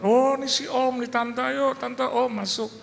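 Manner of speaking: hammed-up neighbour ushering them in; eager host voice. Neighbour waving the pastor into his own house, high eager mock welcome.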